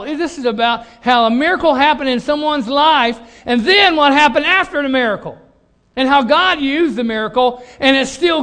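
loud, high preaching voice, building; full-force preaching. Acted. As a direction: preach it out to the congregation.

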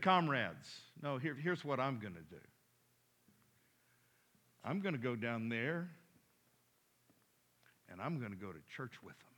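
a hint of a cunning elder devil; quiet, knowing old demon confiding to comrades; sly old demon, low. Older wiser demon speaks.

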